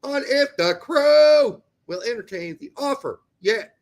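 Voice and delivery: loud, high, punchy news read; news bulletin read. Acted. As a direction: announce it like a breaking sports ticker. Reads transfer news bulletin.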